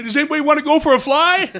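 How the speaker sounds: loud, high, fast shout with laughter; crazed gleeful offer. Retold or imitated. Wrestler opens hatch door in flight, hollering gleefully. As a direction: holler it like a madcap daredevil.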